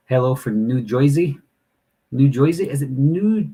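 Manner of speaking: tentative, put-on New Jersey accent. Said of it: "I'm butchering your, your accent".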